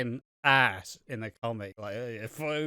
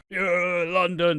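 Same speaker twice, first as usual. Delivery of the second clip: loud call to the crowd; 5 semitones higher, 5 dB louder, much slower than usual. Retold or imitated. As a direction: shout it to the stadium. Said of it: "It's like".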